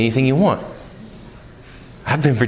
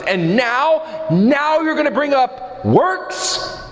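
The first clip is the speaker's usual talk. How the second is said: high, incredulous protest; objecting listener; 7 semitones higher than usual. Retold or imitated. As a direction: push back like a doubting church member. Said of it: "And you say to me".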